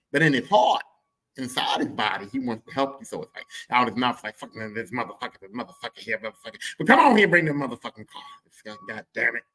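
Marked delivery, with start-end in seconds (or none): 4.4-6.6 dad cussing under his breath. Retold or imitated. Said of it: "That's how he talks". 6.8-8.2 dad's gruff invite. Retold or imitated. Comic telling the crowd how his dad invites them, louder. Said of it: "That's how he talks".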